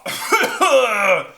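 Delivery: loud, high hammed-up cough; big theatrical coughing burst; dragon cough. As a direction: cough it out explosively. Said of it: "that made him cough".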